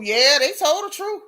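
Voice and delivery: ordinary talk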